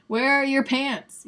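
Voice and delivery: loud, high, slow teasing demand; hammed-up scolding question; mock shock. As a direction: imitate someone scandalized, stretching the words. Voices the joke about camouflage pants.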